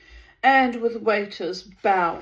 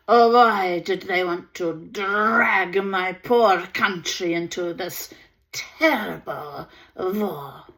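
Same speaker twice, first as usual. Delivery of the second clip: louder, guttural German lament; raised voice, German accent; 5 dB louder than usual. Acted. Baroness lamenting her poor country, louder German accent. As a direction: cry out with German R's. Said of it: "as she raised her voice".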